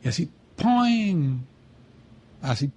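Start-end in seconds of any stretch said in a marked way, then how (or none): 0.6-1.4 high, drawn-out "poing" that falls; imitated boing. Telling a friend how the prayer hit me, drawn-out boing. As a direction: stretch out a springy sound effect, high then dropping. Imitating sudden moment of realization.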